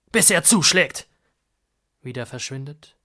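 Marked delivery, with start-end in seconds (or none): 0.1-1.1 sudden higher, louder dramatic shout. Acted. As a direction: burst out with the punchline. Dramatic narration climax.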